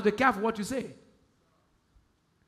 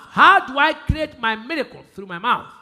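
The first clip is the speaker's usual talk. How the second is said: ordinary delivery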